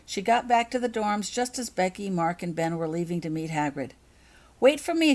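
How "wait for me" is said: high, loud call after friends; shout. A narrator's reading. Holly calling to friends leaving the dorm, shouting. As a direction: call out to stop them leaving. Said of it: "shouted Holly".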